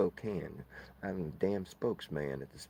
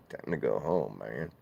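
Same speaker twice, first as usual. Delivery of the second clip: much lower, spoken flat send-off; low spoken Time to go home, man; 4 semitones lower, 4 dB louder than usual. Acted. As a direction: say it low, not sung. Spoken low repeat of sung line.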